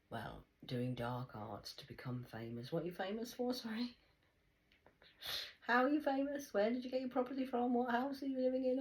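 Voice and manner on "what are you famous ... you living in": higher, louder, pointed questioning; mock interrogation. Challenging the stars about their fame, pointed. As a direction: grill them one question after another.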